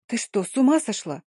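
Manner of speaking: plain reading voice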